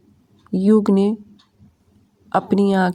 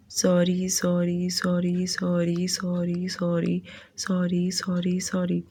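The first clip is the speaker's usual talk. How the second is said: resigned sigh; 7 dB quieter, slower than usual. A narrator's reading. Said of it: "लंबी सांस छोड़ते हुए".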